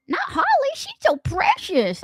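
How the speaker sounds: "precious" in a high put-on coo; mock-sweet defence.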